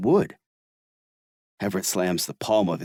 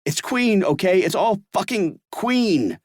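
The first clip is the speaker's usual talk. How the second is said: yelling; 11 semitones higher than usual. Acted. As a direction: shout it to end the argument. Everett yelling continues.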